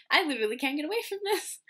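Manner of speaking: high, fast, exasperated rant after a sigh; fast fed-up griping; exasperated complaint. Retold or imitated. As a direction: vent like you're cornered by the topic. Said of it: "and I'm like".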